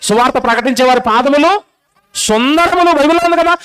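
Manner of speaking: ordinary delivery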